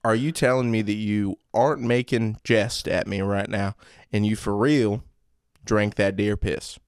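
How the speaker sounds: incredulous Southern father; Southern accent. Acted. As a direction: question the boy in a stern Southern accent. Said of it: "Southern accents?".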